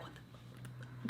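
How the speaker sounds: slow, barely voiced whisper; whispered disbelief. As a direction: whisper it to a fellow eavesdropper. Whispered shared reaction while listening.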